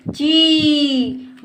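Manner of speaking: ची slow, with sustained ee; held long. Shown as a demonstration. Teacher drills pupils on चा plus matra, held long. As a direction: stretch out the ee in ची. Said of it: "हम देर तक करते हैं".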